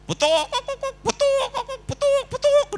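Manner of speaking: high squawking hen cackle, laughing through it; hen clucking. Retold or imitated. Preacher imitates a hen crying out after laying. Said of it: "kenapa ayam kalau bertelur mesti berteriak".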